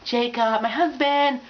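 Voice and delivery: high dreamy Jacob gush; swooning fangirl. Retold or imitated. Doodling girl swooning over Jacob. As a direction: sigh it out like a smitten teen.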